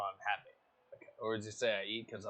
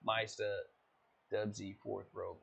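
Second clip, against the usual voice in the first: a hint of race caster play-by-play; lightly voiced grid rundown for the stream; race commentary; 7 dB louder than usual.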